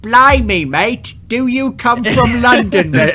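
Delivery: high, loud, bad Cockney accent; mock English accent. Host parodies how Americans fake English accents, bad Cockney voice. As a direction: ham up a terrible London accent. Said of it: "that was my version of when you fuckers are like".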